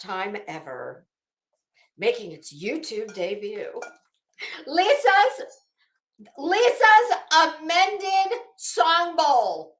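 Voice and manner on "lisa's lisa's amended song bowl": loud, slow, drawn-out showman's announcement; booming slow unveiling of the bowl; grand announcer reveal. Pretend. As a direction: present it like a game-show prize. Grand YouTube debut reveal announcement.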